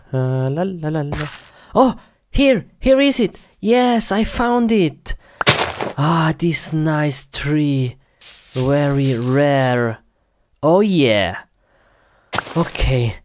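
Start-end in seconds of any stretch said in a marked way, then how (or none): none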